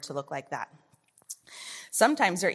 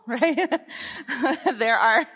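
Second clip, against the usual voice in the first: higher, louder, laughing through the words; real laughing; 6 semitones higher, 6 dB louder, slower than usual. Real, not acted. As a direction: laugh at your own joke while carrying on. Laughs at own obvious joke.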